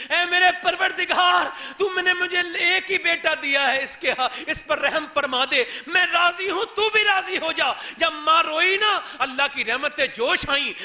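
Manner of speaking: loud, high fervent sermon climax; impassioned preaching. Acted. Preacher telling the miracle of restored hands, loud fervent preaching. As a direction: shout the miracle with fervor.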